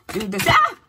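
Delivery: high, loud startled exclamation; startled cry. Real, not acted. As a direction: caught off guard by the cards. Reacts to same cards.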